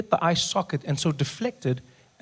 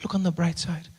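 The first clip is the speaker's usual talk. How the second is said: soothing mother; 8 dB quieter than usual. Acted. Injured mother to her crying son, soft soothing. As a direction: quietly talk a crying child down. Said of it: "And she says".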